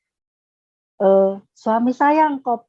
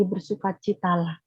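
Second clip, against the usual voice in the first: soft repeat; 2 semitones lower, 7 dB quieter than usual. Retold or imitated. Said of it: "Kukatakan sekali lagi".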